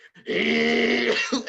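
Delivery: loud, drawn-out mock growl; dog growl imitation. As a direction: growl like a dog about to bite. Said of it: "the dog is growling".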